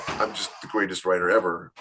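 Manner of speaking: usual speaking voice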